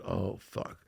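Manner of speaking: low, soft aside of dread; hushed, low "caught out" reaction; retold dread. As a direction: say it under your breath, sinking. Retold dread at wife arriving.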